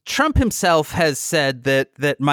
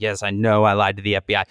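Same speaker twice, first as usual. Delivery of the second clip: lower, quick, lightly voiced meek admission; meek defendant; 6 semitones lower, faster than usual. Flynn answering the judge, lower, lightly voiced meek admission.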